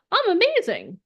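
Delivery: high, chirpy self-celebration; mock-giddy, high-pitched; delighted echo. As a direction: pipe it up proudly in a cartoonish high voice. Subconscious cheerfully echoing the affirmation.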